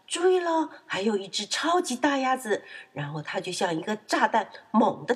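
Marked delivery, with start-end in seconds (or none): 0.1-2.6 high, excited call-out; loud announcement. Acted. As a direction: holler a warning before a big jump. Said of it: "于是就大声喊道". (高声大喊)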